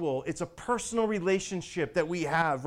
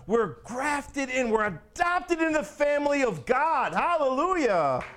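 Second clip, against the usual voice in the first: raised, stretched-out proclamation; exultant preaching; 6 semitones higher than usual. Real, not acted. Preacher celebrates adoption into God's family, exultant. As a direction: build to a ringing praise.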